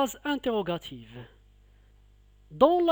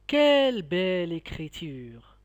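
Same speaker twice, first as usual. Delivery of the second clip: "Quelle belle écriture!" stressing Quelle, exclaiming in admiration; admiring exclamation; 2 semitones lower than usual. Shown as a demonstration. Teacher showing pupils an exclamation, admiring. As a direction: marvel at the handwriting. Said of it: "un sentiment de la joie, d'étonnement ou bien d'admiration".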